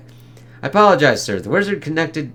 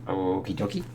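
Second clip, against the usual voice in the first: lower, much softer throwaway okie dokie; mock okie dokie; 5 semitones lower, 9 dB quieter than usual. Viewer mocking the heroes' casual reply, low mumble. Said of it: "It's like".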